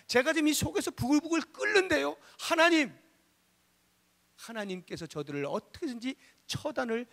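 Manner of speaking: high, heated appeal; indignant pleading prayer. Acted. Nehemiah bringing his seething anger to God, high-pitched pleading. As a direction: cry out an acted grievance to heaven.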